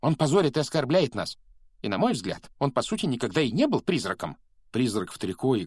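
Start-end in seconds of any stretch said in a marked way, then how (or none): 0.0-1.4 high, loud, fast outraged ghost voice; high-pitched indignant complaint. A narrator's reading. 1.8-4.4 high, loud, fast outraged ghost voice; high-pitched indignant complaint. A narrator's reading.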